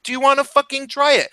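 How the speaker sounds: high, pushy dare; mock tough-guy challenge; retold heated challenge. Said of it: "So I'm like".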